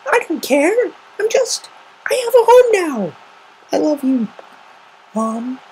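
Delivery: put-on high young filly voice, emotional; a child pouring her heart out to Mom; Scootaloo voice.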